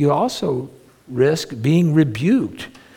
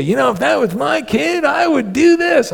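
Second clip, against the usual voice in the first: much higher busybody mom voice; judging mom; 12 semitones higher than usual. Retold or imitated. Meddling mom to a struggling parent, high mock voice. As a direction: mimic a know-it-all mother. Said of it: "I have moms will come in and say".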